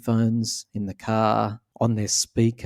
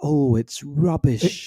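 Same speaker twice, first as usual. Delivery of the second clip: slow, dismissive British scoff; laughing, drawn-out put-down; dry British disdain; 2 semitones higher, much slower than usual. Retold or imitated. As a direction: dismiss it like a bored Brit at work. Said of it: "at work, they go".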